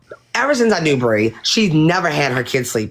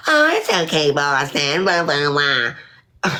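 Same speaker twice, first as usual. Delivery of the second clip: mocking dismissive quote; much slower than usual. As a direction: sneer at them by parroting, pitched up then dragged out. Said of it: "And y'all up there just like".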